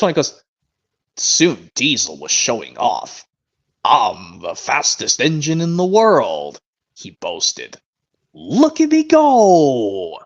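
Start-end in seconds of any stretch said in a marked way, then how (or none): 1.2-7.8 slow, relishing storybook narration; Brandon impression. Fan imitating Brandon narrating Diesel showing off, slow narrator impression. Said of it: "I'll try Brandon's first". 8.3-10.2 high, very drawn-out boastful whoop; Brandon impression. Fan imitating Brandon's boastful Diesel, drawn-out whoop. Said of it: "I'll try Brandon's first".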